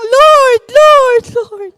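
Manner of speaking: high, loud calls of Lord; high, loud pleading cries; crying out to God. Retold or imitated. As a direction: cry out in desperate prayer. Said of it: "Sabi ko lang".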